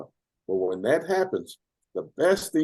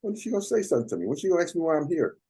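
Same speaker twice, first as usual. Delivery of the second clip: impatient inner thoughts; 8 semitones higher, much faster than usual. Retold or imitated. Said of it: "I'm going".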